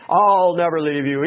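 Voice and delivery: boastful Peter. Retold or imitated. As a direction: swagger on "I'll". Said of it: "braggadocious about himself".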